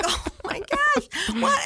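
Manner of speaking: laughing disbelief. Retold or imitated. Laughing disbelief at seeing tickets.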